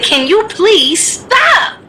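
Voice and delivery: sickly sweet high request; high, slow, strained polite plea. Retold or imitated. Fed-up aide asking the clicking girl, high and slow. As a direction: draw it out high and slow, holding back. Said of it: "I finally said".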